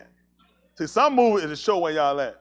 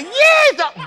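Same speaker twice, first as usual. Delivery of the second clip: high, loud holler of fright; frightened yell; 10 dB louder than usual. Retold or imitated. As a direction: holler it out like he's running scared. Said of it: "he started hollering at".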